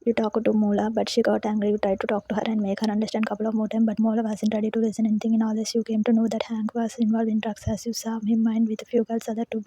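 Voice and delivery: usual speaking voice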